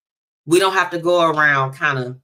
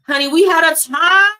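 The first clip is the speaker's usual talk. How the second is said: excited; 10 semitones higher, 7 dB louder than usual. Real, not acted. Excited story opener.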